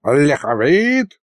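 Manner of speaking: drawn-out sorcerer's call; mock yell. A narrator's reading. Old sorcerer summons his pupil, mock yelling. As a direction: call the name slowly, mildly yelling. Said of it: "завопил колдун".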